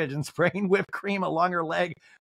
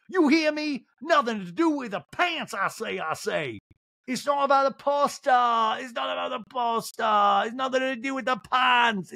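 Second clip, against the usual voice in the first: high shouted indignant rant; hammed-up indignant shouting; ranting Sutton; 10 semitones higher than usual. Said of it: "And Sutton's like".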